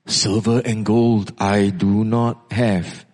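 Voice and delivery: low, slow, solemn declaration; grave apostolic delivery; Peter. Acted. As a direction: pronounce it slowly in a deep, measured voice. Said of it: "Peter's voice spoke out".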